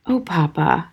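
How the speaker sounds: soft, quiet sigh; breathed softly. A narrator's reading. Daughter sighing to her father at the mirror, soft. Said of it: "breathed softly".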